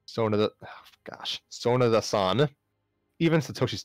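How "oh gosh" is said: ordinary delivery, nothing marked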